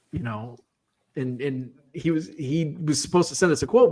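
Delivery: normal talking voice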